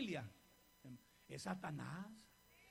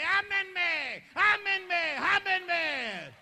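loud, higher pleading call; loud higher voice of God pleading; 6 semitones higher, 11 dB louder, much slower than usual. Acted. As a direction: voice God begging to be loved. Voicing God's plea.

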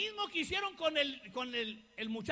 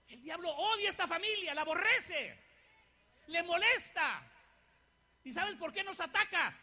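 loud, rising preacher's cry, louder as it builds; full-voiced revival preaching; preaching at full force; 4 semitones higher, 6 dB louder than usual. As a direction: proclaim it to the whole church.

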